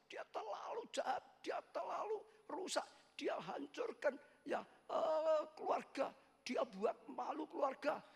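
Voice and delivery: high, rising mock outburst of a hurt mother; bitter refusal. Mother tells the pastor she cannot forgive, high and heated. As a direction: pitch it up and spill the grievance. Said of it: "Dia bilang".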